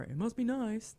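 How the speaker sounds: high, singsong envy; exaggerated envious dig; mock envy. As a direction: play up the jealous jab. Voices an envious remark.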